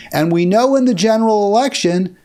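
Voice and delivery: ordinary talk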